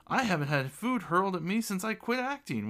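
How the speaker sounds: high-pitched theatrical amusement; amused remark. A narrator's reading. Lord pelted with food jokes along, amused. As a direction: declare it high, as a joke. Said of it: "one lord said amusingly".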